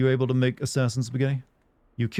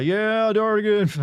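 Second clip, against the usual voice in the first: high, loud call from a passing car; mock fan yell; 9 semitones higher, 4 dB louder, much slower than usual. Fan yelling his name from a car. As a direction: holler it like a fan driving past. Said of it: "drive by and yell".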